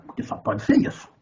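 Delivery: ordinary talk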